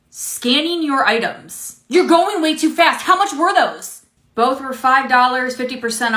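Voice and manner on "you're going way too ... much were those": annoyed complaint. Acted. Customer complaining at cashier.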